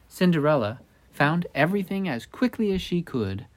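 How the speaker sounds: plain reading voice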